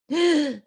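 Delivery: loud startled gasp; sharp gasp. Shown as a demonstration. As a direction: gasp in surprise. Demo of breath showing emotion.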